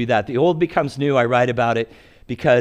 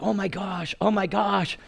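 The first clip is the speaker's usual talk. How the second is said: amazed; 7 semitones higher, 5 dB quieter than usual. Retold or imitated. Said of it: "she's like".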